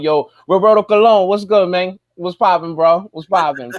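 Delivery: loud, high call-out to a buddy; hype shout-out. Real, not acted. Shouting out a friend to the audience, loud and hyped.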